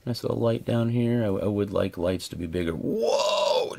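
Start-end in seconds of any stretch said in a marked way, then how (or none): 2.7-3.8 high startled whoa; surprised. Real, not acted. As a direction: gasp at the size of it. Surprised at atomizer size.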